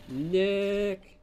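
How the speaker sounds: drawn-out holler; calling out. Acted. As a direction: stretch the name out loudly. Calling out to trigger the camera.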